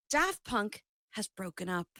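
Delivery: usual speaking voice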